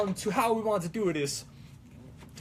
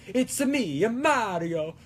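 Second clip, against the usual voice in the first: moderately raised cartoon Italian plumber; sing-song game mascot, short of falsetto; Mario; 2 semitones higher than usual. Shown as a demonstration. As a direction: stretch it out in a less squeaky Italian lilt. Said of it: "if that's a more accurate, a Mario impression".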